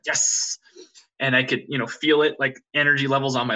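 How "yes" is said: whispered "Yes" ending in a long sibilant; snake-like hiss. Retold or imitated. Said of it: "like a snake come with".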